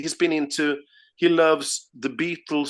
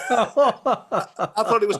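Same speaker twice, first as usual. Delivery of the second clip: laughing through the words; amused, laughing at his own mix-up; 7 semitones higher, 3 dB louder, much slower than usual. Real, not acted. Guest laughing while telling the host his mistake.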